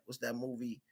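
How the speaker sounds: usual speaking voice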